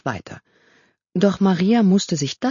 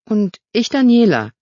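put-on girl's voice; 6 semitones higher, 7 dB louder than usual. Girl introduces herself.

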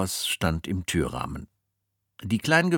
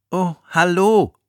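put-on polite boy voice; 12 semitones higher, 9 dB louder than usual. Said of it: "sagte Jack höflich".

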